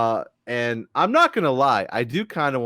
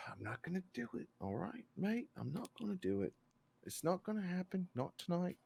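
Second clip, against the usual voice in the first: put-on very soft, high English-accented muttering; hushed, high put-on English voice; English accent, getting into it; 7 semitones higher, 18 dB quieter than usual. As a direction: talk yourself down quietly in a mock English accent. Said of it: "I was getting into it".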